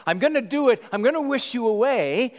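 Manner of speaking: put-on fed-up big sister snapping at the crying baby; loud exasperated threat; frustrated threat. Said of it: "Sarah tells her brother".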